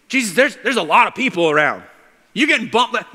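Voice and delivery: loud, high-pitched disbelief; incredulous disciples. Acted. Disciples reminding Jesus of the packed crowd, incredulous. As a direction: protest to Jesus in loud amazement.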